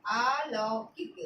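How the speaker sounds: spelled out slowly; slow and clear. Shown as a demonstration. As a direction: say each syllable slowly for pupils. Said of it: "किक, ठीक है". (धीरे-धीरे साफ़ बोलकर)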